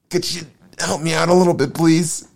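high, quick, meek plea; hammed-up polite begging; acted pleading. Job begs God for help.

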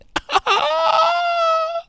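high shrieking laugh; loud burst of laughter. Real, not acted. Breaks into laughter.

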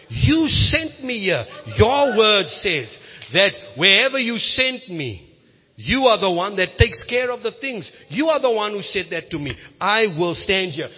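impassioned pulpit preaching; preaching at full force. Acted. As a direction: build the sermon to a bold climax.

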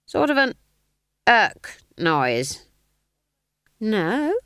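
put-on high feigned-innocent old woman; innocent old gossip. A narrator's reading. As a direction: play dumb, voice pitched high. Nanny voiced high.

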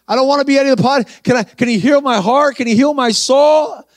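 healed man begging the preacher, tearful; tearful pleading. Retold or imitated. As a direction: beg for a new heart.

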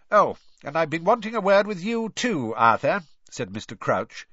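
lightly voiced stern bureaucrat; stern official.

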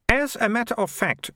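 a lightly voiced confident girl; high girl's voice, Malicia. Malicia explains her plan to Morris, lightly voiced confident girl. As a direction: pitch it up, pleased with herself.

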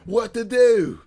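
high, loud mock outrage; theatrical punchline yell; hammed-up video impression. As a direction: cry it out high, imitating the shouter.

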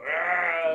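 loud, held mock scream; imitated stage yell. Imitating the performer right after a finished song, long loud yell. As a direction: let out a long "aah" like the character. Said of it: "nothing beats hearing him go".

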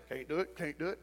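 soft, low, grumbling can't-do-it; a quiet, low, defeated grumble; mumbled defeatism. Retold or imitated. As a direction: mutter it like a beaten man. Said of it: "they come back and say".

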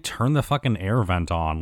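usual speaking voice

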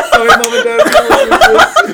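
laughing apology. Real, not acted. Joking apology to parents.

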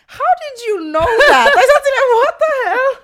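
very high, loud squeal of disbelief; hammed-up incredulous yelling; mock shocked outburst. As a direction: shriek it in exaggerated, laughing disbelief. Acting out shocked reaction to strangers.

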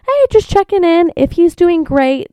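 breezy high voicemail voice; chirpy voicemail. Retold or imitated. Owner imitating vet voicemail, sing-song high. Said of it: "she's like".

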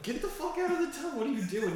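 high, fast exasperated order; retold exasperated order. Ordering a drunk friend out of the tub, high and fast. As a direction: bark at him to get out. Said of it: "And I was like".

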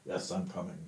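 as if heard through a wall; muffled voice. Acted. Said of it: "you hear a muffled voice go".

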